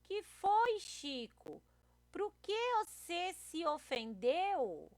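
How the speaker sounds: high, drawn-out questioning; puzzled comic character. Acted. Teacher voices Rosinha asking offended Chico, high puzzled question.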